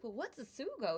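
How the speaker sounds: a hint of a confused American guy; mild mock puzzlement; Bob puzzled. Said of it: "And he's like".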